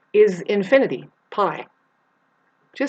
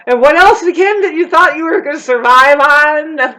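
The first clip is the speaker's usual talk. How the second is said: loud, high mock shout; mocking outburst; 8 semitones higher, 11 dB louder than usual. Ranter to gun owners on survival, high loud mock shout. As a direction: holler the question, pitched way up.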